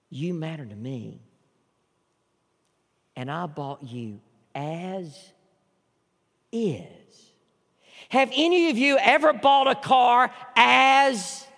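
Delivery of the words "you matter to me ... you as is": very soft and slow, each word held; voice of Jesus. Acted. Jesus speaking to a soul knee to knee, soft and slow. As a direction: speak as Jesus, tender and unhurried. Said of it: "And Jesus says".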